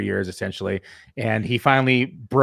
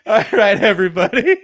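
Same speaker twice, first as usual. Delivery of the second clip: laughing through pain; 6 semitones higher than usual. Real, not acted. Still laughing after the joke.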